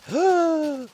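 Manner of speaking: high loud mock gasp; dramatic gasp. Narrator celebrates the receipt with a high loud gasp.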